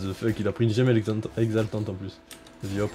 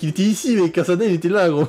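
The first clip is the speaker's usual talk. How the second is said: excited; 6 semitones higher, 3 dB louder than usual. Real, not acted. Replaying the play excitedly.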